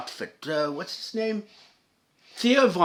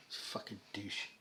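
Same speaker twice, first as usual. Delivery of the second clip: sigh, then a slow, breathy whispered curse; half-whispered aside, slow and much softer; muttered under breath; 9 semitones lower, 21 dB quieter, slower than usual. Real, not acted. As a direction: drop to a whisper in disgust. Disgusted aside after a sigh.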